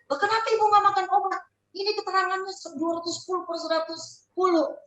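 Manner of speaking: fast, high-pitched questioning; astonished doctor. Retold or imitated. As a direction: voice a doctor taken aback, rushing. Retold doctor challenges the patient.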